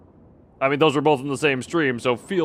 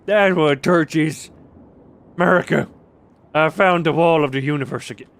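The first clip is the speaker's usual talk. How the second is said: loud, raised, hammed-up American yokel; mock 'Murica patriot; 2 semitones higher, 6 dB louder than usual. Streamer mocking American talk about flashlights and torches, loud 'Murica voice. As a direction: holler it like a proud, indignant backwoods American.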